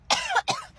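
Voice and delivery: high, pointed throat-clearing cough; pointed fake cough. On the date, waking him with a pointed fake cough. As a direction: clear your throat to get his attention.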